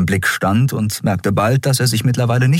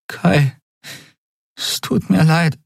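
high, tearful-sounding halting apology; heavy-tongued apology; 10 semitones higher, slower than usual. Acted. Endriel apologises to Kai through tears, struggling to speak. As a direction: force the words out, pitched high. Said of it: "brachte sie mit schwerer Zunge hervor".

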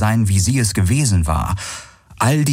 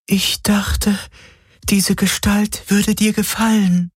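high, wounded female figure voice; hurt shadow apparition; 13 semitones higher, slower than usual. Acted. Shadow being in beloved's shape answers Galen, hurt.

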